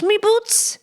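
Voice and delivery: high, playful stab at Irish; mock Irish lilt; attempted Irish accent. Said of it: "How do you do Irish?".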